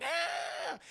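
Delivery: high, drawn-out squeal; imitated rat squeal. Parasite enters the rat's ear, imitated squeal. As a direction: squeal it like a small animal.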